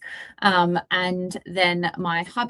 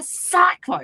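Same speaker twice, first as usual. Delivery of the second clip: exasperated outburst; 11 semitones higher, 6 dB louder than usual. Retold or imitated. Mimics scolding a screaming child.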